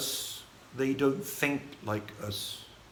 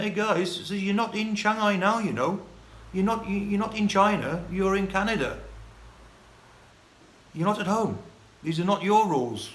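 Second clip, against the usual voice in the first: higher, insistent scolding; retold telling-off; 8 semitones higher, 3 dB louder than usual. Retelling his words to pushy travellers in Vancouver, scolding. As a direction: put them straight, pitched well up.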